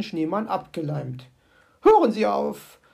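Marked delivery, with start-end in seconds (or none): 1.8-2.8 loud, urgent cry to stop; raised-voice protest; calling out. A narrator's reading. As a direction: call out in alarm. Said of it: "rief sie".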